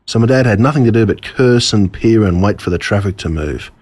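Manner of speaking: ordinary delivery, nothing marked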